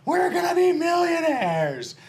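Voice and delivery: shouting it high and slow, thrilled; overjoyed yell, dragging it out; excited husband. Retold or imitated. Husband excited after the meeting.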